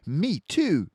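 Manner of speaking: put-on a girl answering her new friend; little girl, glad. A narrator's reading. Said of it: "said Lisa".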